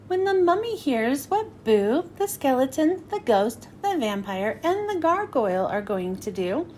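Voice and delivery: ordinary delivery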